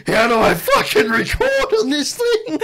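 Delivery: very high laugh-broken squeal; laughing frustration. Retold or imitated. Stoned mate fumbling with his old phone, laughing squeal. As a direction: squeal it while cracking up. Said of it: "And he's like".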